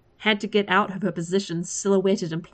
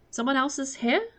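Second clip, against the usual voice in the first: questioning; 7 semitones higher than usual. A narrator's reading.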